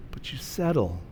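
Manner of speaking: ordinary talk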